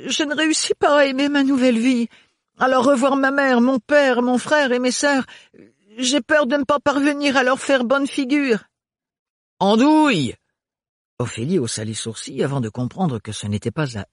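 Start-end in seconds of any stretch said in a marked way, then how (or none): 0.1-8.6 higher, lightly voiced young woman; worried Ophélie. Ophélie confiding to Renard about her family, a little anxious. 9.6-10.3 sharp, raised call of the name; scolding the kitten. Acted. Renard scolding the kitten in his cap.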